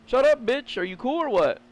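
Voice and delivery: a girl acting tough toward him, mocked. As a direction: play a girl trying to act hard. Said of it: "It's like".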